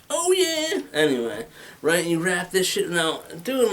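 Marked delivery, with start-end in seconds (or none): 0.1-0.8 high rock singer wail; rocker wail. Shown as a demonstration. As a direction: belt it like a metal frontman. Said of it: "listening to a lot of Gwar".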